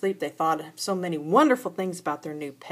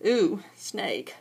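ordinary delivery

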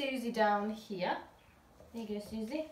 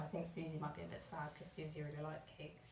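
ordinary delivery, nothing marked

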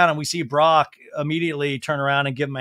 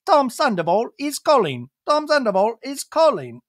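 high, put-on British announcement; British voice prompt; 7 semitones higher than usual. British phone announcing an incoming call, mock-British voice. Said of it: "like, in a British voice".

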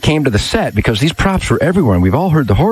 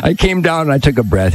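usual speaking voice

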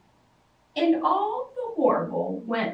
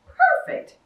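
very high loud exclamation; bear voice; 6 dB louder than usual. A narrator's reading. Bear pleased with his plan, very high loud cry. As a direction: crow it out gleefully.

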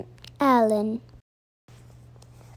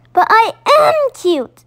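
much higher, louder burst; sudden admission; 9 semitones higher, 6 dB louder than usual. Acted. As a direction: blurt it out with a squeal. Baby Owl finally admits it.

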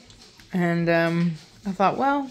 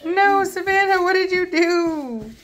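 mock dismay; 9 semitones higher, much slower than usual. Real, not acted. Puppy fights, owner scolds gently.

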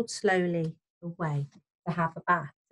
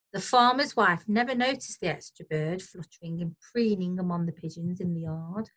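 ordinary delivery, nothing marked

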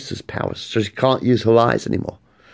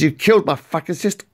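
enraged Luke; 7 semitones higher, faster than usual. Acted. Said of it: "In rage. He says".

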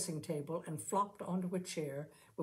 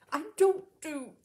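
higher, louder, slow indignant start; 10 semitones higher, 9 dB louder than usual. A narrator's reading. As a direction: begin an indignant protest. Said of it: "Amy began indignantly".

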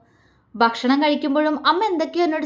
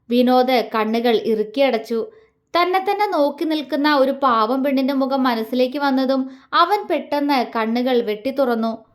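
ordinary delivery

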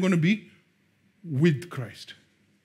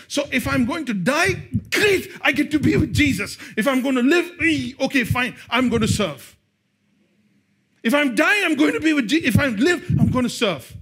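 preaching at full force; 5 semitones higher than usual. Acted. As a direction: build the fire on every line.

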